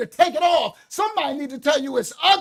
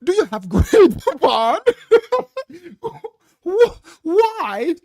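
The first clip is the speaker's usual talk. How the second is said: hammed-up prissy voice breaking into laughter; mock polite laughing; 4 semitones higher, 6 dB louder, slower than usual. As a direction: parody a timid gentleman, laughing. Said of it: "be all nice and calm".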